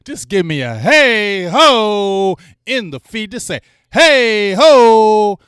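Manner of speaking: loud, slow, drawn-out chant call; drawn-out callout; slow hype call. Real, not acted. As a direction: whip the chat up. Calling chat to chant.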